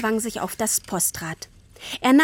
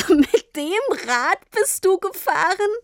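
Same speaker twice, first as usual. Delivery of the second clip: put-on higher, slow astonished child's question; high girl voice; 9 semitones higher than usual. A narrator's reading. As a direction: ask like a stunned little girl. Girl astonished at postal bike. (Tini fragt ungläubig nach dem Postrad)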